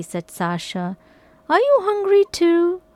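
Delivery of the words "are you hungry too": put-on high girl voice. A narrator's reading. Sasha asks the cat.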